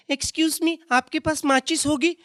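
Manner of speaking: high polite lady voice; lady's sweet request. Retold or imitated. Stand-up mimics a lady asking for a match, high and polite.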